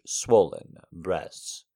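ordinary delivery, nothing marked

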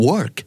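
American-style WORK, clear r-sound. Shown as a demonstration. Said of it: "มันจะมี R ที่อยู่กลางคำด้วย".

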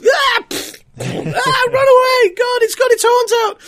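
high squeaky panicked screaming; squeaky panicky sidekick. Pretend. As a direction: shriek like a fleeing victim. Voicing panicking victims.

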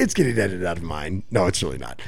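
low, quiet mock of the co-host; hushed, deep mock echo; imitating co-host. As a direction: drop low to mimic his remark. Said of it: "He said that's getting".

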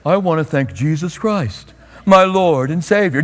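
mock-reverent interview piety; showy pious voice. Shown as a demonstration. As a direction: ham up the humble athlete thanking Jesus. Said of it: "Just don't do it like this".

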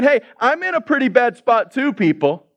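high-pitched sarcastic mock plea. Troubled Jesus addressing people in the same spot, sarcastic. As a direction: toss out the question as plainly absurd. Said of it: "Do you hear the sarcasm?".

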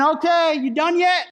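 mock impatient God waving off the mourning. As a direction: play God as fed up and eager to move on. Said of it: "God is essentially saying".

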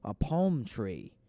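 put-on slow, stretched-out British accent; very British understatement, drawn out. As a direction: try a stiff British accent, lingering on words. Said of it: "Like, a British person leaving that is like".

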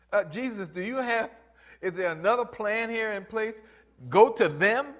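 mock disbelief at the plan; incredulous question. Lepers question Jesus.

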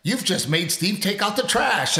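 high, slow hammed-up prize announcement; game announcer. Slot machine tells the player her prize, mock announcer. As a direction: announce it like a game show win.

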